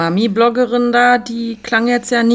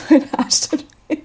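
laughed through, breathy and high; laughing complaint; 7 semitones higher than usual. Real, not acted. Co-host jokes about her aching backside while laughing. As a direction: giggle out the complaint about your sore backside.

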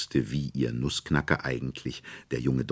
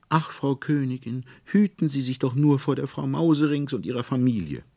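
put-on higher trembling girl voice; fearful girl; 11 semitones higher, 4 dB louder than usual. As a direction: warn anxiously like a little girl. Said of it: "zitterte und bebte Marie".

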